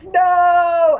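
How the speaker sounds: loud, high anguished cry of no. Retold or imitated. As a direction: wail it like your favourite just died. Reacting to character's death.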